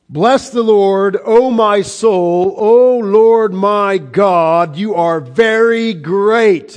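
slow, loud, lifted scripture reading; solemn psalm proclamation. Acted. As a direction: proclaim each phrase with awe. Reads psalm in lofty preaching.

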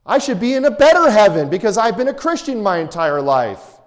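high, loud indignant complaint; mock the entitled churchgoer, high and loud; whiny self-righteous believer.

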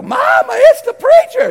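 put-on high, very loud child's yell; panicked kid hollering through the house; screaming kids. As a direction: shriek a warning to Mama. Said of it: "And the kids go".

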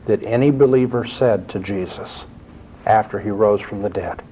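ordinary talk

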